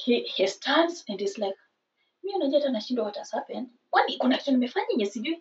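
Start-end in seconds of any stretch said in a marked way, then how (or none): 2.2-5.4 quick, soft confused muttering; talking to himself. Retold or imitated. Boyfriend wondering what he did wrong, muttering to himself. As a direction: gabble it under your breath. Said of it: "Now he starts talking to himself".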